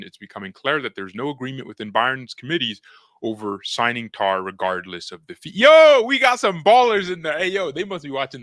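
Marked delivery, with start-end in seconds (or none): 5.5-8.4 excited. Real, not acted. Hyped greeting new viewers.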